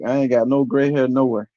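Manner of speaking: normal talking voice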